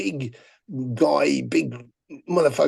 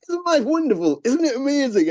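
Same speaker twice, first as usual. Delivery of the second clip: much higher, sugary mock cheer; mocking sing-song; 10 semitones higher than usual. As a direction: parody a gushing influencer. Fan mocking his upbeat post.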